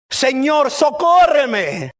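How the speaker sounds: high, drawn-out call for help; acted desperate appeal; pleading cry. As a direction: cry out, stretching the plea. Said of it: "se postra ante Jesús diciéndole".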